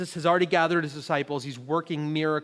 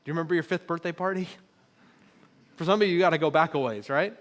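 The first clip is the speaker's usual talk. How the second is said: usual speaking voice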